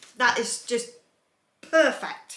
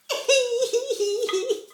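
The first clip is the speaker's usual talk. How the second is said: high-pitched tearful laugh; overcome, laugh turning to sob; blubbing; 14 semitones higher, 3 dB louder than usual. Real, not acted. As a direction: squeal of happy crying. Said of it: "before I stop blubbing here".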